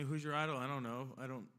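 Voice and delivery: normal talking voice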